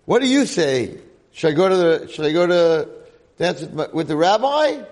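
loud, high mocking call to the girl; retold young man loudly baiting the rabbi; taunting challenge. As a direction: ham up the showy dare. Howie taunting to girl on lap.

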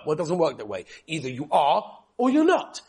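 ordinary talk

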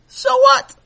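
a loud, high, incredulous retort; incredulous challenge. Acted. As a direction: toss it back in loud disbelief. Man challenges Sammy.

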